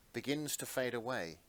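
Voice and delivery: ordinary delivery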